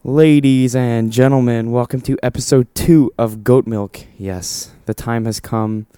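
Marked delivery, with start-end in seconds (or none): 0.0-4.0 slow, grand show intro; announcer voice. Acted. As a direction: announce it like a host.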